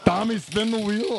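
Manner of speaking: hammed-up Schwarzenegger bark, laughing through it; Schwarzenegger impression. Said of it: "the standby Schwarzenegger".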